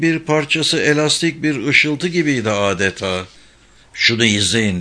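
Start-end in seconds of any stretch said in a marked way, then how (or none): none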